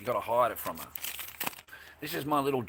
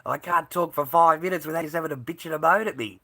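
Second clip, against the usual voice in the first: fed-up outburst; 6 semitones higher, 10 dB louder than usual. Real, not acted. Streamer complains about chat moaning.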